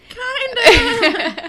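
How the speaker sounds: high, loud squeak into laughter; mock overwhelmed. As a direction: imitate an overwhelmed date, half laughing. Voicing overwhelmed date.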